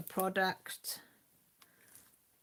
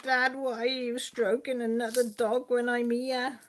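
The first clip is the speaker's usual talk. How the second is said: higher, plaintive pet-dog voice; whining dog; 6 semitones higher than usual. Retold or imitated. Jealous dog Lily complaining to her dad, mock whine. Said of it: "Lily was whining and pulling".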